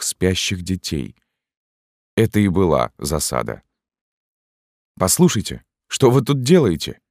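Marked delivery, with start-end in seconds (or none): 5.0-7.0 shouting. A narrator's reading. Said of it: "крикнула маркетантка".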